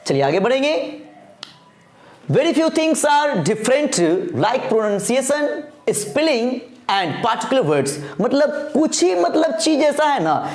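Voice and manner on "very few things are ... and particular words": slow, deliberate reading of the English line; slow dictation reading. Acted. Teacher reading the note aloud to students, slow dictation. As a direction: lay each word down slowly for copying. Reads the text slowly.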